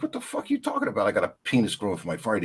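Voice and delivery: mock stunned patient; shocked disbelief. Patient reacts to diagnosis.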